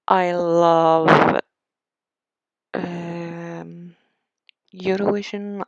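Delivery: loud, drawn-out burst of enthusiasm; emphatic gushing. Real, not acted. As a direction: belt it out like a devoted fan. Gushing about what she loves.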